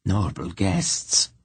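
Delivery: slow, even, toneless welcome; a witch greeting guests without feeling; strangely neutral voice. Acted. Witch greets her guests in a slow, neutral voice. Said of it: "in that strangely neutral voice".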